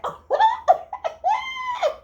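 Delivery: high, loud shrieking laugh; screaming laugh. Real, not acted. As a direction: crack up out loud. After the ass-whooping line.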